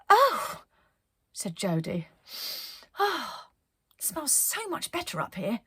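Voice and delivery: high, relieved sighing voice; relieved delight. Acted. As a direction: breathe out the relief. Jodie relieved at top.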